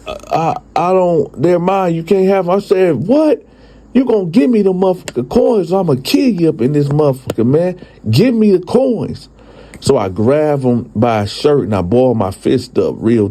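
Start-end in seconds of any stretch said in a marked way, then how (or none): 0.1-2.6 high, stammering refusal; lightly voiced frightened homeowner clinging to his coins; scared old man. 3.1-9.3 high, sudden what, then a fast threat; high, furious threat. Retold or imitated.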